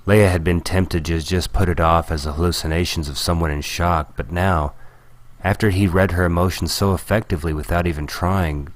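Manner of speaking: ordinary delivery, nothing marked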